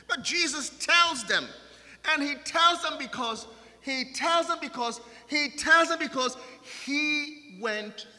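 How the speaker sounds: high, loud, building repetition; full-force preaching. Acted. Pastor driving the point home to congregation, loud preaching.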